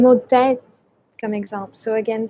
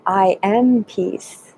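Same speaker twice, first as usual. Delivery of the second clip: slow affirmation; much slower than usual. Shown as a demonstration. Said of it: "You can say".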